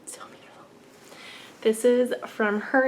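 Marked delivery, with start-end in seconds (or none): 0.0-0.7 hushed, very soft whisper; awed whisper. Real, not acted. Vlogger admiring the cardigan picture, awed whisper.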